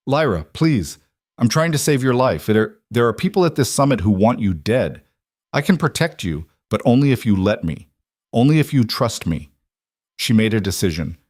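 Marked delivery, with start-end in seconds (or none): none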